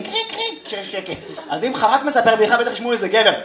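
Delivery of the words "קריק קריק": high, thin imitated chirping; cricket chirp. Comic imitating crickets after a failed joke, high chirps. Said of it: "שהם צרצרים".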